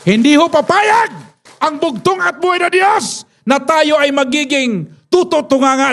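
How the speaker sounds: preacher stirring the church to act; fiery preaching. Real, not acted. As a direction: drive the point home to the congregation. Preaching at full force.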